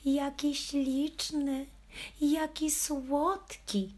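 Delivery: high, slow gushing squeal; squealing cooing ladies. Acted. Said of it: "wzdycha na mój widok i piszczy". (wysoki, przeciągły pisk zachwyconych paniuś)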